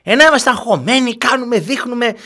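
high sarcastic mimicry; mocking the panic.

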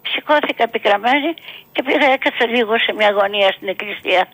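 usual speaking voice

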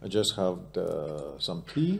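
soft British-tinged South African English; British-leaning accent. Shown as a demonstration. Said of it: "Μου βγήκε πιο πολύ το British". (βρετανική προφορά με νοτιοαφρικανική χροιά)

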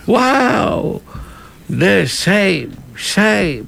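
high, drawn-out cries of shame; British parliament heckling. Retold or imitated. Guest mimicking the uproar in Britain's House, mock heckling. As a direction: jeer like a British MP, pitched well up. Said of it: "Και με προφορά επίσης".

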